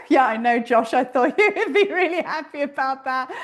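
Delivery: real laughter breaking into the remark; amused teasing. Real, not acted. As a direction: tease a friend while laughing.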